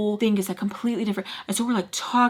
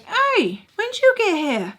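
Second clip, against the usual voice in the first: much higher voice for Charlie; Charlie's voice; 12 semitones higher, 3 dB louder than usual. Retold or imitated. Charlie greeting her on the couch, high and bright. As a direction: pitch up to play the friend.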